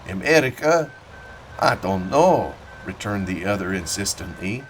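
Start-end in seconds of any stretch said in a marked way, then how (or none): 0.1-2.5 put-on thick German-accented old farmer, reminiscing; a proud old German immigrant telling his war story. Old German farmer telling Annixter about Gravelotte, in a thick German accent.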